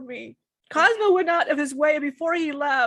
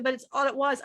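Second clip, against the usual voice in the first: ordinary talk, nothing marked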